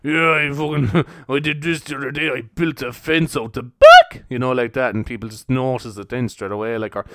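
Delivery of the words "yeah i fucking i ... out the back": slow, louder tough-guy boast; bragging big-man voice, slow and loud; macho big man. Retold or imitated. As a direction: puff yourself up like a hard man. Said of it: "trying to act like a big man".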